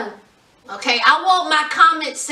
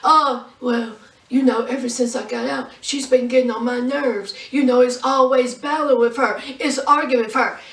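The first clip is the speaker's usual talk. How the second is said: low, subdued grumble about a partner; mock Michael; 5 semitones lower than usual. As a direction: play him as a low-voiced complainer. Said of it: "And then here's Michael".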